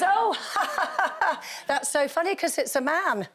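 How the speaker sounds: high, laughing mock gushing; mock delighted laughter. Rant about double standards on the show, mock gushing with laughter. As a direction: gush through a laugh, sending up the viewers. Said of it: "And everybody goes".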